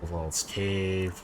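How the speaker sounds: ordinary talk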